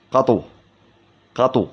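قطو as quick qa-tu, ط not doubled; light single ط. Shown as a demonstration. Teacher showing another dialect form, قطو with a single light ط. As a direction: lighten قطو to one short ط. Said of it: "تخفيف الطاء".

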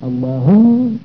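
"اللهم" slowed, humming on the long m; drawn-out "اللهم" with a geminated m; doubled mim. Shown as a demonstration. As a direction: hold the mim of "اللهم" at length. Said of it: "ميم مشددة".

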